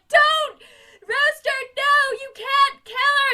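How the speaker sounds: high, loud pleading shout; desperate scream. Retold or imitated. Miss Hannigan rising to stop Rooster, high loud pleading shout. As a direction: throw everything into stopping him. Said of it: "She's like".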